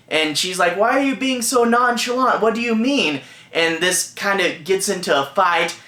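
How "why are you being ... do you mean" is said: higher, mock-exasperated wife; retold exasperation. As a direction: imitate a wife demanding an explanation. Wife confronts husband in quarrel.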